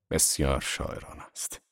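low, soft, barely voiced reply; unenthused agreement. A narrator's reading. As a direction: agree under your breath, without enthusiasm. Tom's unenthused reply to Daisy.

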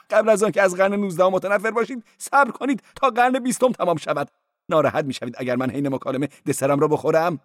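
high-voiced cleric. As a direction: play the cleric high and quick.

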